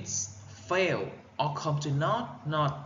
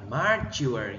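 "mature" as MA-ture, weight on MA; wrong first-syllable stress. Shown as a demonstration. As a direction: show the mistaken stress on MA in "mature". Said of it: "Không phải đọc là". (nhấn sai vào âm một của "mature")

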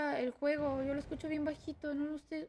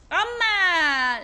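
loud, falling attack cry; triumphant shout; 3 semitones higher, 11 dB louder than usual. Real, not acted. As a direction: yell it as the blow lands. Attacking an enemy in game. (grito de ataque)

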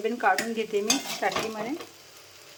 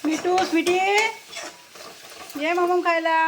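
high, loud sweet calling to the parrot; sing-song call to a pet parrot; coaxing pet call; 7 semitones higher, 4 dB louder than usual. Real, not acted. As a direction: coax the bird over to eat.

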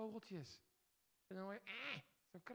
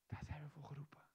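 whisper; 4 semitones lower than usual. Acted. Quiet moment, eyes closed.

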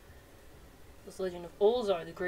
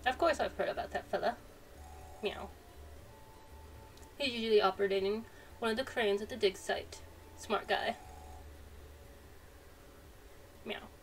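cat merchant voice; 2 semitones higher than usual. Acted. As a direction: play a chatty cat merchant who meows between lines. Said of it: "Thought he was going meow again".

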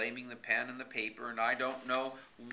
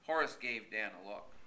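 usual speaking voice

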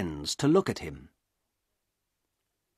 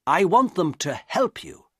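understated anger, pitched up; angry; 6 semitones higher than usual. A narrator's reading. Doctor wants nurses to help his patient, mildly angry. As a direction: insist with a touch of anger. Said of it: "I said angrily".

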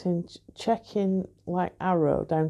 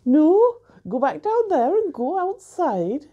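put-on higher, louder northeastern English accent as the barman; exaggerated northeastern barman imitation; 10 semitones higher, 5 dB louder than usual. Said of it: "a northeastern accent there?".

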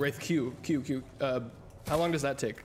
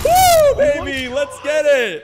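very high, loud cheering whoop; victory cheer; 16 dB louder, much slower than usual. Real, not acted. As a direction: whoop like you just won. Winning the match.

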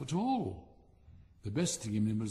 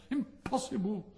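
much higher, outraged exclamation; indignant disbelief; 8 semitones higher than usual. Acted. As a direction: refuse to believe it, pitched way up. Rocket protests while flung away.

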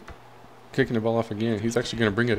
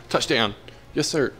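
loud, higher celebration; excited; 4 semitones higher, 3 dB louder than usual. Real, not acted. Gamer cheering own touchdown, excited. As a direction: burst out with the joy of scoring.

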